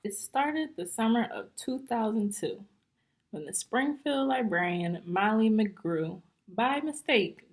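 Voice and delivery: lively, bouncy rhyming storybook reading; story read-aloud. Acted. Teacher reading a rhyming book to young students, lively. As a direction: read it out to the class, full of life.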